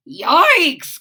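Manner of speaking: loud disgusted yelp; cried out. Acted. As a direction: yelp at the smell. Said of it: "cried Froggy".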